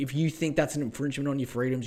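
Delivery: ordinary talk, nothing marked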